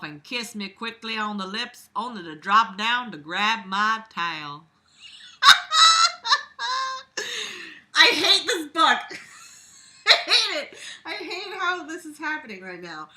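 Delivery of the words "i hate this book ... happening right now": high, breaking laugh through the words; laughing helplessly. Real, not acted. Reader cracking up at the awful book, laughing.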